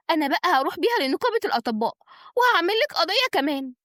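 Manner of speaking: high, fast, indignant tirade; angry outcry. A narrator's reading. Patient threatens the crooked doctor with reporting her, angry. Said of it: "قالت بصراخ وغضب".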